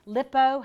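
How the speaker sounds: "lipo" said as a variant, set apart and stressed; alternate pronunciation. Shown as a demonstration. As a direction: try another way of saying "lipo". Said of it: "however you wanna pronounce it".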